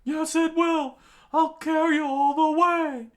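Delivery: very high, loud strained shout; determined yell. Acted. Usopp carrying Dalton, insisting, determined high yell. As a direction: yell back stubbornly.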